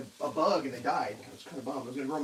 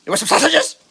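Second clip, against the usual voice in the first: high, louder singsong asking the dog; pet talk; 9 semitones higher, 5 dB louder than usual. Real, not acted. Offering sausages to his dog Berta, high pet talk. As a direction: coax the dog with a high, eager voice.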